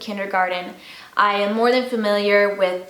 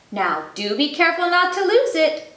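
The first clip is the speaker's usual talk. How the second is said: higher playful teasing mother; 8 semitones higher than usual. Acted. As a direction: tease him lightly. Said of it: "she teased him".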